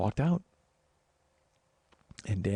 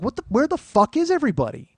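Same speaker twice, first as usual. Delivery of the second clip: high, loud retold panic; exasperated alarm; 12 semitones higher, 7 dB louder than usual. Witness retelling that nobody came to help, exasperated alarm. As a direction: re-enact calling out in disbelief for help.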